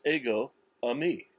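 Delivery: "ego, eimi" spoken as two separate Greek words; Greek pronunciation. Shown as a demonstration. Preacher showing listeners the Greek for I Am, Greek pronunciation. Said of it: "that's how it's pronounced in Greek".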